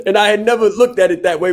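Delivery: laughing. Real, not acted. Laughing reaction to friend's quip.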